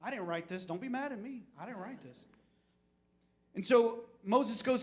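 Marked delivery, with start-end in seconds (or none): 0.0-2.2 mock sheepish plea. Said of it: "They're co-, grumbling, they're complaining".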